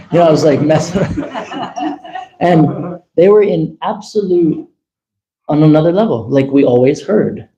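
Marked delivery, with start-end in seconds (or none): 1.3-2.3 laughs high and soft at his own joke; a quick high laugh, barely voiced; high giggle. Real, not acted. Laughing softly after teasing about the girls.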